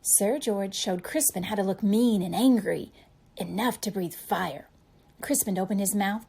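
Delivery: ordinary delivery, nothing marked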